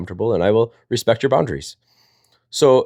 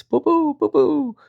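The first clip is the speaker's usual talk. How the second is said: high squeaky boo boo noises; popping sounds; much slower than usual. Pretend. Dad joking about twins, squeaking high little pops.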